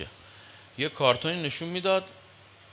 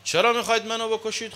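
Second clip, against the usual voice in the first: louder, higher, with a push on چرا; raised voice, چرا hit hard and high; loud pleading question; 6 semitones higher, 5 dB louder than usual. Shown as a demonstration. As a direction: cry out چرا as an accusing appeal. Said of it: "نگفت".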